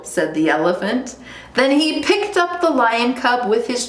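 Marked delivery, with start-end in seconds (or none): none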